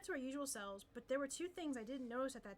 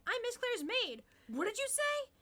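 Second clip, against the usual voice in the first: surprised; 11 semitones higher, 7 dB louder, slower than usual. Acted. Maid's startled reply.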